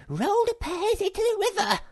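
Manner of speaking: a small creature shrieking with glee; excited scream. A narrator's reading. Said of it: "screamed Sniff excitedly".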